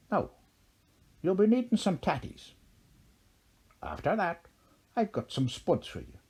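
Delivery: Irish leprechaun lilt, high then settling; playful Irish leprechaun patter; broad Irish accent. A narrator's reading. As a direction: serve it up in an Irish brogue. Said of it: "their Erin accents were so broad".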